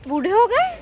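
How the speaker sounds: high rising incredulous question; mock surprise. Real, not acted. Teasing her mother about age, loudly surprised. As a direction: tease her with disbelief.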